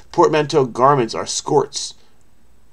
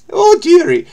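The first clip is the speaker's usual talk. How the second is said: put-on much higher, louder old Scottish nanny voice; Mrs Doubtfire; 8 dB louder than usual. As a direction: squeal it like a fussy old nanny. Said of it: "it seemed like Missus Doubtfire".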